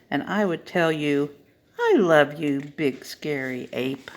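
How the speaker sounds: lively storybook reading, voices swinging high; animated picture-book reading for a children's story time. Acted. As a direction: read it like a bedtime book for little ones.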